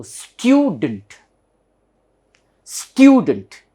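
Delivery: STUDENT as drawn-out, stressed STYOO-dunt; "student" with a y glide and punched first syllable; correct pronunciation. Shown as a demonstration. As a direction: lean hard on "styoo" in "student". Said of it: "therefore it should be stew".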